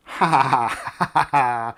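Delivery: drawn-out burst of laughter; real mocking laugh. Real, not acted. Host laughing at Australian viewer's cold weather, open laughter. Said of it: "Sorry, shouldn't laugh".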